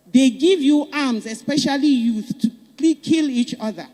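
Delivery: an indignant speech-maker's shout. Real, not acted. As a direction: call it out with rising anger. Impassioned rallying speech.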